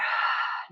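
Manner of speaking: breathy sigh out; releasing sigh; demonstrated exhale. Shown as a demonstration. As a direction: let go with an audible out-breath. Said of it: "exhale and let the arm drop".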